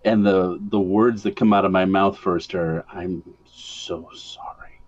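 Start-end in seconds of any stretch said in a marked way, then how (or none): 2.9-4.8 slow unvoiced whisper. [name] leans to the box and whispers an apology. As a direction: breathe an apology, barely a sound. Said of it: "I have to whisper".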